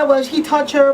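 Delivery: ordinary talk, nothing marked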